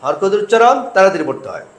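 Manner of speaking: ordinary delivery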